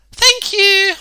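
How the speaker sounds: high, loud cheerful thanks; a kind woman thanking him brightly; bright woman's voice. A narrator's reading.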